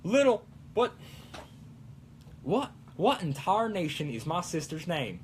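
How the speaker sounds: Applejack. Acted. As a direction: puzzle it out in a folksy southern accent. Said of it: "And Applejack, like".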